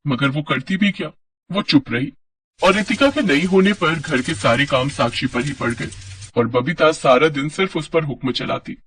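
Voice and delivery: plain reading voice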